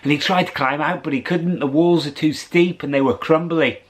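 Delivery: usual speaking voice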